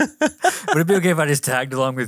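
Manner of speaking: put-on eager pleading child. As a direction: plead like a clingy kid. Pleading to Mary.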